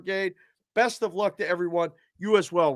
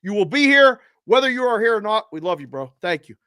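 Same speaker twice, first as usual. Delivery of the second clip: usual speaking voice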